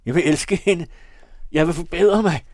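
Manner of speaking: loud, high desperate plea; vehement shouting. A narrator's reading. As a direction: cry out, pleading for life. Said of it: "skreg han heftigt".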